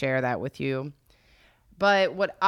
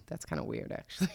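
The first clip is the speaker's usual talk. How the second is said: soft unvoiced aside; whispered aside; 7 dB quieter, faster than usual. Real, not acted. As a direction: whisper it under breath.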